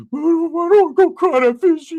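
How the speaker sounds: put-on high, loud whimpering teen voice; crying cousin voice. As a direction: wail it like a scared kid cousin. Crying cousin Ricky talking.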